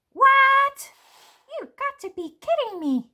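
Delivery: very high and loud, appalled seed; indignant squealing seed; outraged shriek. Acted. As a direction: shriek in disbelief at being eaten. Seed outraged at being eaten.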